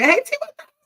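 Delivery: excited greeting. Real, not acted. Host calling out to a viewer who just arrived, excitedly. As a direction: call out happily to a newcomer.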